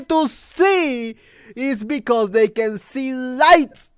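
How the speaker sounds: high, loud, slow, laughing through the words; incredulous laughing rant. Real, not acted. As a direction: laugh in disbelief while ranting.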